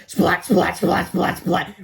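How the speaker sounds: loud punchy splats; splat noises. A narrator's reading. Narrator sounding out eggs hitting the crowd, loud splats. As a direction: smack each splat out. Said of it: "went the eggs".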